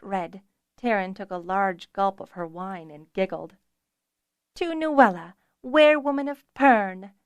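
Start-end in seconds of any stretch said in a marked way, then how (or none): 4.6-7.1 high, loud toast called out; cried toast. Acted. Taryn toasts her friend, calling out high and loud. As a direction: raise your glass and call it. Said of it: "Taryn cried".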